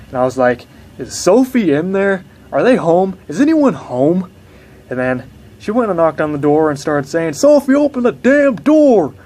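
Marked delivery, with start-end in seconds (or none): 1.0-4.3 higher, louder questions; worried calling. Retold or imitated. Asking sister loudly. 7.3-9.1 high, loud yell at the door; angry yell to open up; shouting. Retold or imitated.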